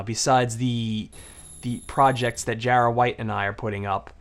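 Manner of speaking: usual speaking voice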